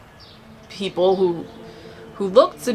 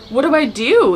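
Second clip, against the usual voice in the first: higher, louder urgent asking; anxious question; 6 semitones higher, 4 dB louder than usual. Retold or imitated. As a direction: beg for direction, voice up. Asking the lady for help.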